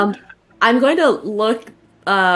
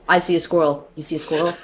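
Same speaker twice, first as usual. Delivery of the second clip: a hint of dogs whispering, lower and softer; imagined dog chatter; 6 semitones lower, 5 dB quieter, much faster than usual. Two dogs in the background spotting a squirrel, mild dog voices. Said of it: "are gonna be like".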